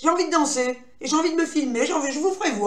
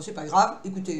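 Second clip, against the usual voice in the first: ordinary delivery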